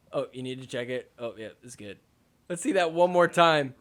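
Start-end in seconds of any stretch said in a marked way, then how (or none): 0.1-2.0 low, soft, offhand murmur; quiet mock aside. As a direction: play a hushed sideline check. Acted exchange about the hurt foot.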